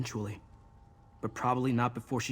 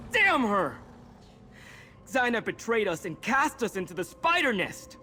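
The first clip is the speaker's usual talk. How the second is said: furious outcry; 9 semitones higher than usual. Acted. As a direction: rage at a trusted mentor's betrayal. Companion reacts to betrayal.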